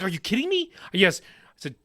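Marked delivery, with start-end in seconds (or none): 0.0-0.7 high, rising incredulous question; mock disbelief. Imitating family's reaction to the joke, mock disbelief. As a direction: squeak it like a stunned relative.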